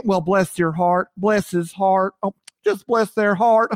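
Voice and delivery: light Southern drawl imitation; sweetly condescending Southern lady; Southern phrase. Host imitating Southerners saying bless your heart, light drawl. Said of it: "in the South, they say".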